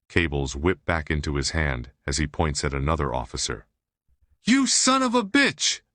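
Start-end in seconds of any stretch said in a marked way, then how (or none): 4.4-5.8 much higher, louder raging howl; furious howl. A narrator's reading. Cornered criminal howling at police, furious howl. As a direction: howl it at the officers, full fury. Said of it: "Diago howls".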